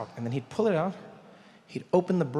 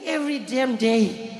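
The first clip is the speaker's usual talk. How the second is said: weary drawl; 6 semitones higher, 3 dB louder, slower than usual. Retold or imitated. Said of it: "he's like".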